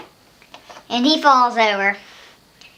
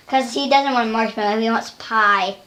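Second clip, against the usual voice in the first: ordinary delivery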